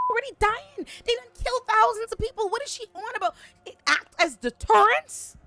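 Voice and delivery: high, loud, fed-up ranting; exasperated rant. Real, not acted. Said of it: "it has run me hot".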